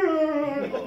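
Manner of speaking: high buzzing "ugh" of someone being shocked; electrocuted groan. Retold or imitated. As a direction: imitate a body jolting on live current. Imitating his head shaking from the shock.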